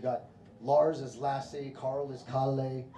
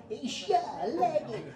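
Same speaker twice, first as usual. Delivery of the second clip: put-on much higher, creaky old-timer voice; old man saying tja; 11 semitones higher, slower than usual. Comic mocking a seventy-five-year-old still saying tja, high old voice. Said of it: "eighty, still saying".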